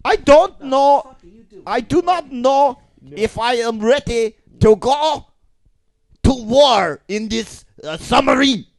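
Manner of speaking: a dithering German sailor, hammed up; cartoon German. Debating going to war by submarine, high cartoonish German accent. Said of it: "You both sound like cartoon characters".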